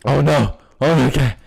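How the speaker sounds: strained voice after screaming; low, worn-out throat; hoarse. Real, not acted. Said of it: "I'm screaming too loud".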